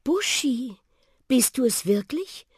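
high, airy little car voice, amazed; a small old car recognising her love; little lady car. A narrator's reading. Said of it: "fragte Susi".

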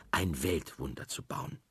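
usual speaking voice

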